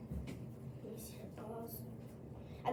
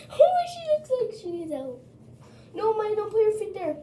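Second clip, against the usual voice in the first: excited high voice; 8 semitones higher, 7 dB louder than usual. Real, not acted. Reacting to the dog, raised voice.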